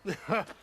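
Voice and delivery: quick amused laugh, rising; a brief snort of laughter at the irony; one short wry laugh. Real, not acted. Amused at rival remark.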